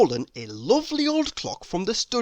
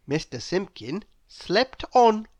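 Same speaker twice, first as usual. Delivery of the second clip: ordinary delivery, nothing marked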